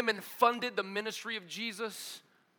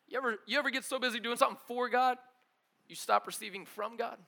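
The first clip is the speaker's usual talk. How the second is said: usual speaking voice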